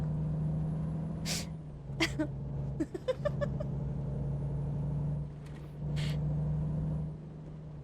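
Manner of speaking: high breathy giggling fit; long wheezing laugh. Real, not acted. Streamer laughing at her own Wichita, long breathy giggle. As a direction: laugh helplessly at yourself.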